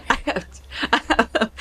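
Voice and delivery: giggling through the words; laughing too hard to start; can't get it out for laughing. Real, not acted. Laughing at a memory.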